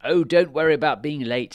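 big, hearty storybook king; Fairy King. A narrator's reading. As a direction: reassure grandly at high volume. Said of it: "said the Fairy King".

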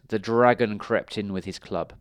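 ordinary delivery

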